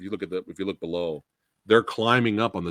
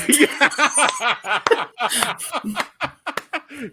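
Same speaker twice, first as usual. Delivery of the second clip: very high, squealing laugh; shrieking with laughter; 4 dB louder than usual. Real, not acted. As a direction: crack up at the answer. Laughs at co-host's joke answer.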